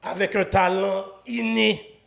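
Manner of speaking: big, drawn-out show introduction building to a shout; hype announcer intro. Acted. Host presenting tonight's guest to the audience, hyped announcer style.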